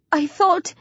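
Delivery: high-pitched, desperate begging; wailing. A narrator's reading. Trembling boy begging friend to save him, wailing. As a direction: beg for life in a high wail. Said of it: "Mark wails".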